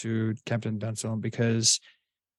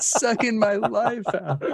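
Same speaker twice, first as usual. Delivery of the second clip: higher, slow, laughing whine; mock suffering; 10 semitones higher than usual. Acting out a bored viewer.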